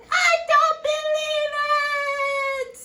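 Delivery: high, drawn-out, loud queenly shriek; angry shout. A narrator's reading. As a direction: hold every word in a furious storybook cry. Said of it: "shouts the queen".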